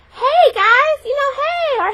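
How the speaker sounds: put-on high, loud, dazed greeting from a half-blind girl; mock dazed blind girl. Poked bully girl greets everyone, squeaky and loud.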